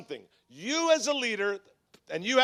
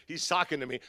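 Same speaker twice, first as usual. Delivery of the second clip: ordinary talk, nothing marked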